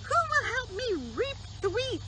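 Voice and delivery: high hen voice asking. Acted. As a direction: squeak it out as the hen. Hen asks in character.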